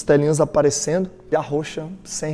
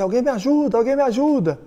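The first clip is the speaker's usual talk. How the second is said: much higher, retold plea; desperate cry for help; 8 semitones higher than usual. Father carrying his lifeless daughter, re-enacted desperate cry. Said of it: "desesperado, falei assim".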